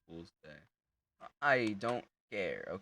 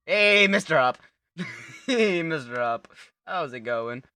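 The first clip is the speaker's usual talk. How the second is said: loud, high hail; playful, repeated holler; calling out; 6 semitones higher, 9 dB louder than usual. Pretend. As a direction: shout hey to get someone's attention. Calling out to game character.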